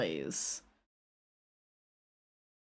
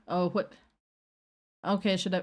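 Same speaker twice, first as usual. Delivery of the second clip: normal talking voice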